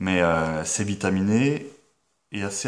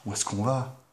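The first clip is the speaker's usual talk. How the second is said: inner whisper; 7 dB quieter than usual. Retold or imitated. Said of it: "je me suis dit".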